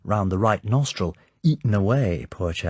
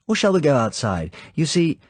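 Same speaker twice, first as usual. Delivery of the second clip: high, louder, animated villain's welcome; the Count's voice; 8 semitones higher, 5 dB louder than usual. Acted. Count welcomes Sir Hilary, light animated high voice. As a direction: greet the guest lightly, full of animation.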